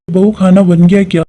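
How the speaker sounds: a mother-in-law calling her daughter-in-law. Acted. Mother-in-law calls to bahu. (बहू को ज़ोर से पुकारती सास)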